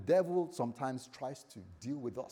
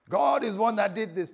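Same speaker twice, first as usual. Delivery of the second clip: loud, high blaming outburst; hammed-up cry of blame; mock accusation; 7 semitones higher, 9 dB louder than usual. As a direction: raise pitch and volume to accuse. Acts out someone blaming God.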